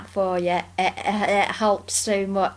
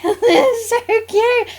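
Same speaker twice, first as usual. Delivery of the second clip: much higher, louder, slow laugh-talk; 12 semitones higher, 6 dB louder, slower than usual. Real, not acted. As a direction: laugh through the words. Laughing at cute race.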